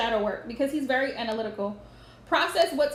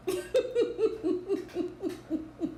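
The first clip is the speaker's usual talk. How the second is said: long, higher-pitched giggle; amused laugh; 6 semitones higher, 4 dB quieter than usual. Real, not acted. Reader reacting to a listener's comment, drawn-out amused laugh. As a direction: let a chuckle run on in short pulses.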